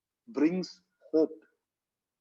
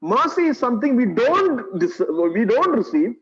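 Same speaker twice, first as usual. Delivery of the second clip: loud, raised sermon voice; forceful preaching; 4 semitones higher, 6 dB louder than usual. Real, not acted. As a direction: drive the teaching home to the congregation. Preaches on grace and mercy.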